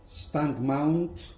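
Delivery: ordinary talk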